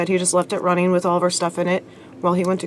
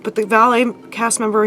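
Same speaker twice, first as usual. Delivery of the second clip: usual speaking voice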